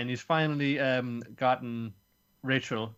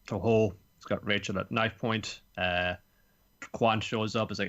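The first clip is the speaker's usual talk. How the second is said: usual speaking voice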